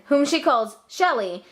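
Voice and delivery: plain reading voice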